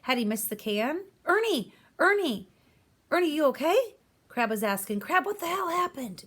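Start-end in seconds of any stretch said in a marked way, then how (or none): 1.2-4.0 high, loud frantic calls; shouting. Acted. Shouting at injured boy. 5.0-6.2 put-on high, demanding old man; demanded. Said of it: "Grandpop demanded".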